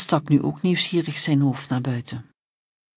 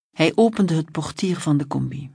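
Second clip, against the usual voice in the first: ordinary delivery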